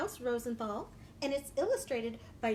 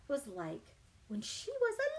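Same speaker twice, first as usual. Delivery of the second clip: usual speaking voice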